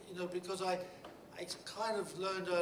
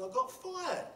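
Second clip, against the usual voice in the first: retold shout; 12 semitones higher, 5 dB louder, slower than usual. Retelling shouting to coworkers.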